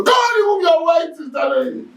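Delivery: high, sudden mock shout; imitated shouted order. Preacher retelling what the O C said, in a high imitated shout. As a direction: yell it out like an officer giving orders.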